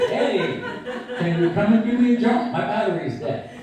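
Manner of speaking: loud, laughing mock plea for a jump start; half-laughing, call out for help. Old friend on the phone asking for a jump, laughing loud.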